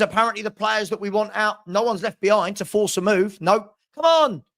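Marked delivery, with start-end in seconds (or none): 3.9-4.4 exasperated. Real, not acted. Exasperated outburst.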